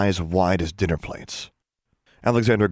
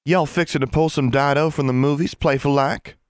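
higher, curious Ozark twang; intrigued Southern girl; 7 semitones higher than usual. A narrator's reading. Constance asks about the movie trick, intrigued and twangy. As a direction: lean in, intrigued, with a country lilt. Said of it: "sounding intrigued".

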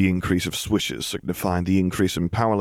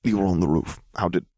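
dazed, halting. A narrator's reading. Dizzy man haltingly asks about roof.

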